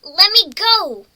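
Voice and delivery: loud, desperate cry to be released; pinned and struggling, yell to get free; shout. Acted.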